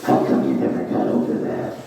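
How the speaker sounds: very low, voiceless rasping inner voice; inner monologue. Acted. Inner monologue taunting the comic about old insecurities, low raspy whisper. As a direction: needle him in a deep, whispery rasp. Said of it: "conversation with me and my inner monologue".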